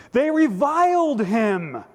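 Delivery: high, slow, forceful proclamation; declamatory reading. Acted. Preacher reading how they reviled him, declamatory. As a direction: proclaim it high, stretching the verb.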